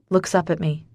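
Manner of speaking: ordinary delivery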